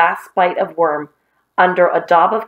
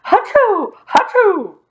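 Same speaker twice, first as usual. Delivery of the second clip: two high, hammed-up hachoos; theatrical mock sneezing; pretend sneezes; 13 semitones higher, slower than usual. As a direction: fake a sneezing fit in a high voice. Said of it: "pretending to sneeze".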